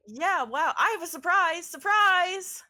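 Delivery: high, loud, overeager greeting; manic excitement. Acted. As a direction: burst out giddy and breathless. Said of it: "She goes".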